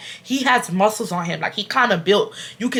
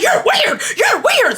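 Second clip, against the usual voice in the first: scornful taunt; slower than usual. Retold or imitated. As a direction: sneer it twice. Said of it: "So I was like".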